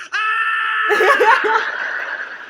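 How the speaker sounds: high, loud scream of shock; startled scream. Acted. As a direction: scream as if caught off guard. Man startled at seeing the pranksters.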